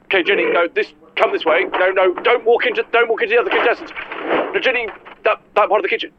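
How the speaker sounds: urgently steer someone away; flustered warning. Acted. Host stopping Jenny walking into contestants, flustered.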